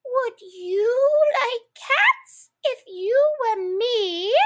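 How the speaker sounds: high, slow indignant squeak; shrill passionate mouse. A narrator's reading. The mouse indignantly squeaks at Alice. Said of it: "shrill, passionate voice".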